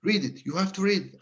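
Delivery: high insistent plea; insistent quoted plea. Retold or imitated. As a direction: urge him to read it. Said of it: "and he was like".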